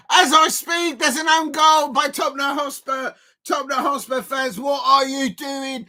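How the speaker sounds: high-pitched, exasperated live reaction; shocked disbelief. Real, not acted. Reacting live to an own goal.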